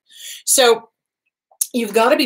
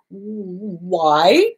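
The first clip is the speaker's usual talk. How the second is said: ordinary talk, nothing marked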